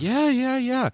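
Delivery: high-pitched placating reply; brushing it off. Retold or imitated. Son retelling his reply to dad's advice, brushing it off. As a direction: rattle off agreement way up high.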